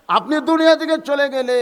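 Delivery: high, loud grieving plea; acted lament. Daughter fearing her father's passing, high loud lament.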